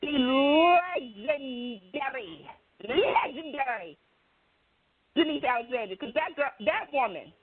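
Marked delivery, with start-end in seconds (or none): 0.0-6.0 emphatic announcer introduction. Shown as a demonstration. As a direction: give the name a big star build-up. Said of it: "you gotta do it right".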